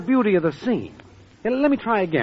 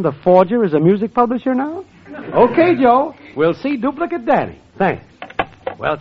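ordinary delivery

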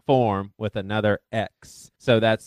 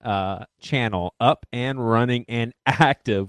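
ordinary talk, nothing marked

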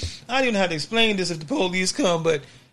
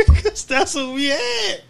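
laughing; 10 semitones higher, 4 dB louder, slower than usual. Real, not acted. Laughing at own outfit.

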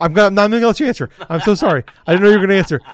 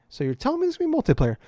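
quick, higher, softer questioning voice; mock interviewer; 8 semitones higher, 5 dB quieter, much faster than usual. Host imitating interviewer's question, mock eager. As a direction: lightly mimic a credulous interviewer. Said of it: "And the guy's like".

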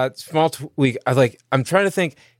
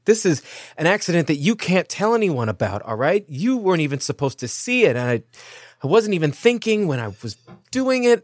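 higher, agitated explaining; flustered insistence; 6 semitones higher, faster than usual. Acted. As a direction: urgently plead for secrecy. Jonnet frantically insists on secrecy.

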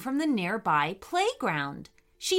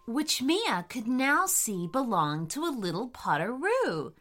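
usual speaking voice

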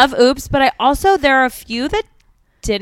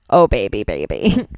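much lower, spoken pop lyric; interpretive lyric read; 12 semitones lower than usual. Shown as a demonstration. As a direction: drop right down low on the lyric. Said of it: "it's an interpretive read".

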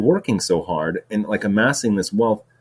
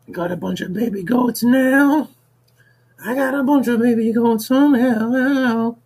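sung much higher, Yoda-like [name] croon; [name] doing Yoda; 4 dB louder than usual. Pretend. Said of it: "[name] does freaking Yoda".

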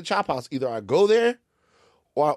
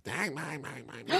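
mock Southern twang on nonsense syllables; thick Southern accent; 3 semitones lower, 10 dB quieter than usual. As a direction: softly imitate Southern chatter. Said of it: "They have very thick Southern accents".

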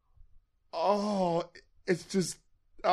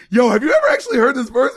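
excited call; 9 semitones higher, 8 dB louder, faster than usual. Retold or imitated. Calling friend, amazed about verse.

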